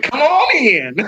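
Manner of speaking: laughing Southern welcome; hammed-up Southern hospitality; Southern accent. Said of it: "I've been in North Carolina too long".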